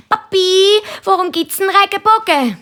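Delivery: hohe, laut rufende Kinderstimme; löchere den Papi mit hoher Kinderstimme; fragendes Kind. A narrator's reading. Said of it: "stellt, äh, Nina und der Christoph ihrem Papi ständig Fragen". (hochi Chinderstimm, fröglet)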